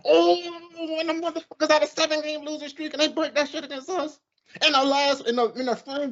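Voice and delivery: high-pitched indignant complaint; incredulous, worked-up rant; outraged disbelief. Retold or imitated. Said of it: "I'm like".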